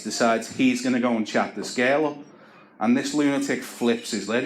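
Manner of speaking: normal talking voice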